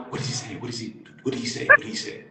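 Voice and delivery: hushed, urgent background questioning; quick half-whispered pestering; furtive, overheard. Retold or imitated. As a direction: imitate someone asking eagerly off the phone. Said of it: "doesn't think I can hear her".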